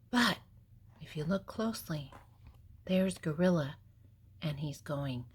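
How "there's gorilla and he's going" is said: usual speaking voice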